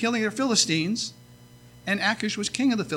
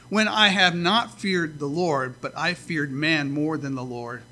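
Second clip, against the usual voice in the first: ordinary delivery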